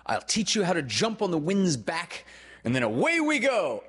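put-on high, rising boyish excitement; thrilled. A narrator's reading. Peter promising Wendy flight, high and thrilled.

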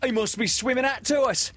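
high, loud startled pirate. Acted. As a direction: sailors thrown off guard. Starkey speaks.

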